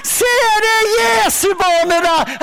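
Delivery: high, slow jeering shout; mock shout with a sneering laugh. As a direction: yell it across the bus to mock him. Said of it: "Så roper de ut".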